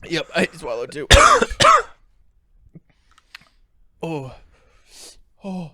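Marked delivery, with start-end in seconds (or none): 1.1-1.8 sharp burning cough from hot pepper; loud choking cough. Real, not acted. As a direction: hack it out, throat on fire. Coughing after swallowing hot pepper.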